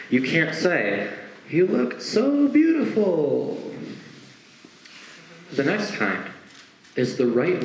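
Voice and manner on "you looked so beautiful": higher, slow swooning praise; gushing compliment. Acted. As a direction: dote on them a bit too much. Wrong line in a quote.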